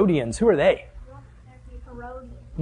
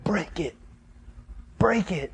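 hushed prayer; 9 dB quieter, slower than usual. Acted. Prayer of surrender to Christ.

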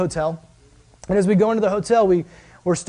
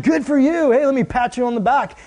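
hammed-up praise; 6 semitones higher than usual. Mock praise of showy piety.

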